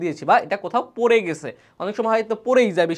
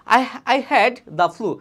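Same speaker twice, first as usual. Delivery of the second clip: slow model reading; 3 semitones higher, much slower than usual. Shown as a demonstration. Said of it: "teacher models the line slowly".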